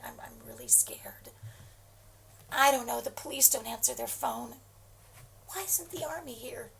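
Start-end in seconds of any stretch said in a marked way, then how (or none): 0.1-1.3 scared whisper. Acted. Hiding in safe room.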